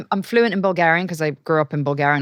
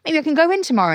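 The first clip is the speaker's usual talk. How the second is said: rising, hopeful plea; 9 semitones higher than usual. Retold or imitated. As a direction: coax him into agreeing. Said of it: "I said to my husband".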